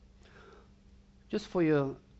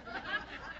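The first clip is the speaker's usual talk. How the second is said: soft embarrassed laugh; 12 dB quieter than usual. Real, not acted. Laughs at own accent.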